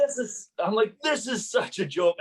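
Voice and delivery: high, loud angry outburst; angry protest. Retold or imitated. Landlord yelling at the hearing officer before being escorted out. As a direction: yell it out in disbelief. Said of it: "I kept on getting mad".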